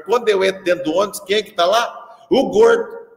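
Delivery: high, loud punchline build; excited reveal. Retold or imitated. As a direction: burst out with the surprise. (o professor conta empolgado quem estava no ônibus, alto e agudo)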